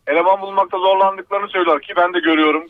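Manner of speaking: ordinary talk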